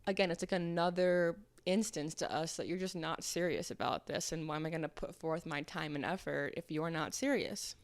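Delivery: normal talking voice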